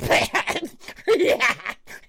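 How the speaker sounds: high, yelping cries of panic; hammed-up startled shrieking; imitated shrieks. Imitating her son dive-bombed by a bat, shrieking. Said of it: "Bernie's doing this".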